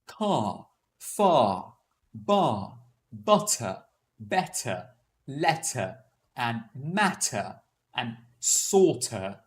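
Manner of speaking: word list in British RP, each ending on an open vowel, "car" as "cah", "butter" as "buttah"; measured list with "letter" as "lettah" and "porter" as "pawtah"; silent final R. Shown as a demonstration. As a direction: drop the R at the end of "far", "better", "potter". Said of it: "Hence, I didn't pronounce the R sound".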